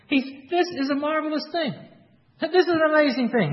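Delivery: amazed. Retold or imitated. Healed man marvels at Pharisees.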